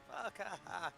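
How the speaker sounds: acted-out scornful laugh with a curse; curse, then laugh at him; mock laughing. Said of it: "you just laugh at him".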